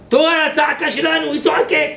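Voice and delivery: loud, high exclaiming of the answer; rabbi's retort. Retold or imitated. Rabbi answering the troubled man, loud and high.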